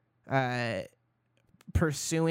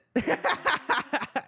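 airy unvoiced laugh; breathy laugh; 11 semitones higher than usual. Real, not acted. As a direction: laugh silently at your own joke. Laughing at own joke.

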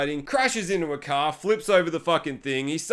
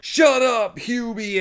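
put-on bratty kid snapping; 7 semitones higher, much slower than usual. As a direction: fire it back like a rude teen.

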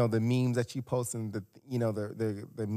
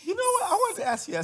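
much higher, excited burst; eager jump in; faster than usual. Real, not acted. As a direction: leap in, high and keen. Jumps in eagerly.

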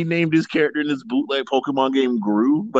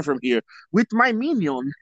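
lightly hammed-up villain voice, slightly higher; character voice; 3 semitones higher than usual. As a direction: ham up a silly accented villain. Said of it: "What voice is that?".

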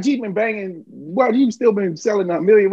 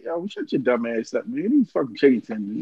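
put-on lower, dismissive kid voice; son scoffing; 8 semitones lower, 4 dB quieter than usual. Dad voicing his son mocking him about tenders. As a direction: brush off dad like a know-it-all son. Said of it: "he looked at me like".

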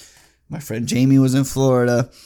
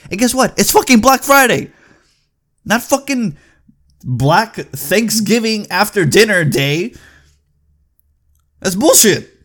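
much higher and louder, fed-up yelling; angry rant; 9 semitones higher, 6 dB louder than usual. Real, not acted. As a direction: let the fury boil over at the store.

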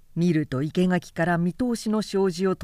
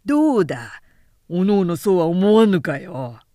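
loud, swaggering tipsy samurai at a drinking party; boisterous young samurai; 2 semitones lower, 6 dB louder than usual. Acted. Young samurai egging on his drinking friends, loud and swaggering. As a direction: throw the question out loudly to the table.

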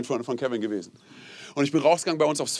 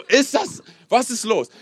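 exasperated; 9 semitones higher, 5 dB louder, slower than usual. Retold or imitated. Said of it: "Und du bist so".

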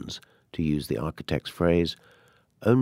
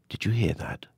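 hushed, breathless whisper in the dark; frightened whisper; 2 semitones higher than usual. Acted. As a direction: whisper so nothing downstairs hears. Woken by noise in bed.

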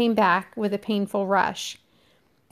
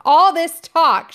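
shouting; 8 semitones higher, 8 dB louder, slower than usual. Acted. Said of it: "shouted Ralph".

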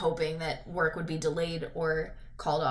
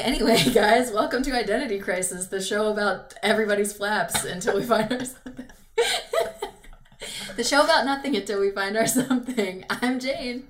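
podcast host intro; 3 semitones higher, 5 dB louder than usual. Acted.

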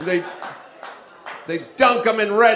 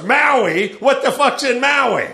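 mock incredulity; 6 semitones higher, 4 dB louder, slower than usual. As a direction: pitch climbing into baffled disbelief. Imagined disbelief nobody would voice.

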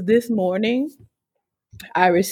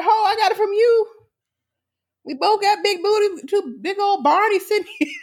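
sassy grandma; 11 semitones higher, faster than usual. Retold or imitated. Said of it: "she wanna say".

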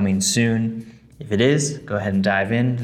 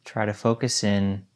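guided meditation. Acted. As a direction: guide them gently into stillness.

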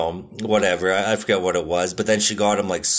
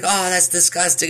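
higher, put-on disgusted sister; mock disgust; 9 semitones higher than usual. Sister biting a baking-powder donut, mock disgusted. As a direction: play the sister spitting out the donut. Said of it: "She was like".

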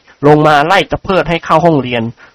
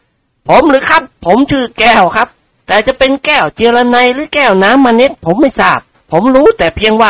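higher, cocky defiant voice; 8 semitones higher than usual. A narrator's reading. Thug student answering the teacher, higher and defiant. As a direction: answer with swaggering pride. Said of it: "อย่างทรนง".

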